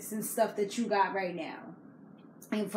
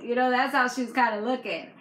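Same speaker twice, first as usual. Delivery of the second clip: normal talking voice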